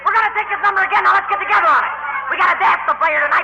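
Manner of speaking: calling the band. Acted. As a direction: call out to the fellas.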